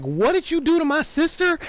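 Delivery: high, loud mock outrage; outraged nun. Battle nun avenging her sister, high, loud outrage. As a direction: shriek it like an offended sister. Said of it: "They're like".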